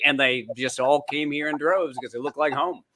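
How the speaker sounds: ordinary talk, nothing marked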